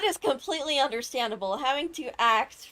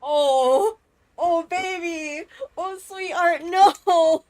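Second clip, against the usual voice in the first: hammed-up pitying wail; 7 dB louder, slower than usual. Streamer pities sad game character.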